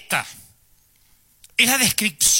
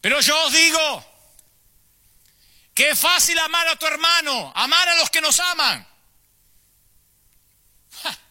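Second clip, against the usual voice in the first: Jesus preaching on the mountain; 8 semitones higher than usual. Acted. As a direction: project it to the last person on the hillside. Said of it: "fuerte para que lo escuchara el último". (Jesús predicando a gritos en la montaña)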